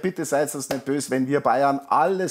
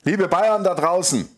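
excited call; 5 semitones higher, 4 dB louder than usual. Acted. Stadium announcer addressing fans.

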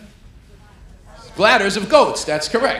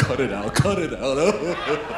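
laughing protest; 3 semitones lower, 4 dB louder, much slower than usual. Retold or imitated. Barmaid laughing, retold.

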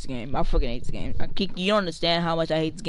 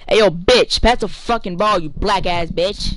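loud, furious demand for the ball; angry yell; 3 semitones higher, 8 dB louder, slower than usual. Real, not acted. Gamer screaming at a teammate to pass him the ball, furious yell. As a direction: scream for the pass, the last words slow.

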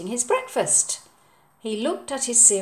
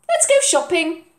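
bright mum; 8 semitones higher, 5 dB louder than usual. Acted. Mum's voice.

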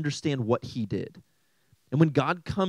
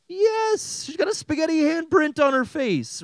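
very high, incredulous exclamation; exasperated squeal; 3 dB louder than usual. Acted. Uncle states the obvious about his niece, high exasperated squeal. Mock-obvious answer to own question.

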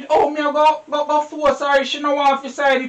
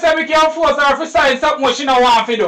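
louder, arguing his point hard; loud protest; 3 semitones higher, 5 dB louder, faster than usual. Acted. Man arguing loudly with his woman about the signature. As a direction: push back at her with force.

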